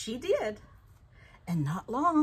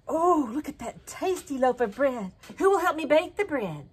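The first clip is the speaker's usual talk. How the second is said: higher, louder hen calling out; 5 semitones higher, 5 dB louder than usual. Acted. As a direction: call out to the farmyard, hopeful. Hen calls for helpers.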